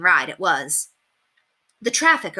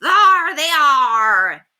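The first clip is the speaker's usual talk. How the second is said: 1 pirate cry; 9 semitones higher, 8 dB louder, much slower than usual. A narrator's reading. Pirate spotting the children.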